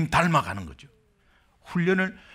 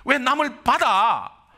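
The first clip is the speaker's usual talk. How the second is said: higher, indignant rant at the other driver; angry blaming; 7 semitones higher than usual. Retold or imitated. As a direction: blame him, outraged and higher. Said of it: "마 원망했어요". (사고 상대를 원망하며 높이 따지는 목소리)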